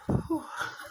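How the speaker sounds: heavy exhale, no words; long voiceless sigh; exasperated sigh. Retold or imitated. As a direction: let out a big breath at the ignorance. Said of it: "I'm like".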